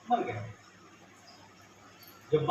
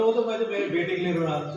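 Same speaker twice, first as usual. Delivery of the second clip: loud plea; 3 semitones higher, 7 dB louder, faster than usual. Acted. Father answers the child.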